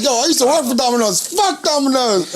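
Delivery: hammed-up Domino's worker rant; mock cursing out. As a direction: rant at the rival chain. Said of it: "cursing each other out".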